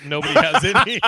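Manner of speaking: loud, high, helpless laughter; laughing hard. Real, not acted. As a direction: burst out laughing through the words. Laughs at own interrupting joke.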